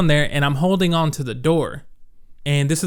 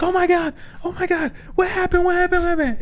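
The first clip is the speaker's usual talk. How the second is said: very high, frantic repeated cries; panicked mom. Retold or imitated. Mom running out to her run-over child, panicking. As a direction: mom in full panic, pleading to know.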